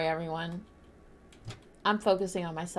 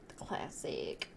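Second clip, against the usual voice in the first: low slow whisper; 11 dB quieter, much slower than usual. Real, not acted. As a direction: murmur it as a secret aside. Whispered aside.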